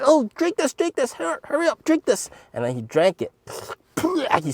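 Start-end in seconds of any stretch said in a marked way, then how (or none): 0.0-2.3 very high, mock-sweet hurry; fake-urgent coaxing. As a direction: hurry him with fake concern. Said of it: "I'm like".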